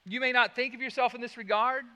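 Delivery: normal talking voice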